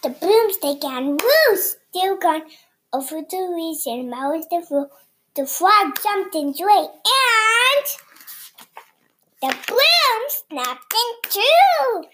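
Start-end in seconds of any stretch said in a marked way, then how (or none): 5.3-12.0 excited. Acted. Excited story climax.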